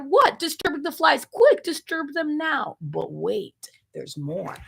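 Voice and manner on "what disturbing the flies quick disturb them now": excited command. Acted. Frantic command.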